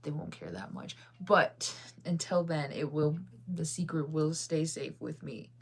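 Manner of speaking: ordinary talk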